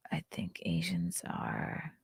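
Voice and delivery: breathy whisper, half to herself; whispered aside. Real, not acted. Reviewer muttering an aside to the camera, whispered. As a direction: let the words slip out under the breath.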